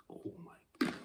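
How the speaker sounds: gasped under the breath at a bad smell; smelling something awful. Real, not acted. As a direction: recoil from a foul stink. It smells horrific.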